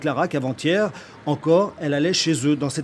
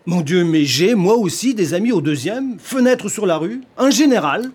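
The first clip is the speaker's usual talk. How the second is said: higher and louder, rising in alarm; excited outburst; 5 semitones higher, 7 dB louder than usual. Acted. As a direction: blurt it out, climbing in agitation. Husband bursts out about the general.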